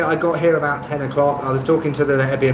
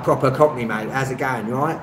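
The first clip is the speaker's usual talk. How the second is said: casual Cockney pub greeting, Ts dropped; lazy, matey London Cockney. Shown as a demonstration. Londoner showing own drunk-in-the-pub side, matey Cockney. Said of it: "I can be like".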